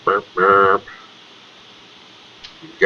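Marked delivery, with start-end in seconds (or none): 0.0-0.8 higher, machine-like burp, burp; mock burp noise. GM voicing the crate's burp again. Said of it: "gives another".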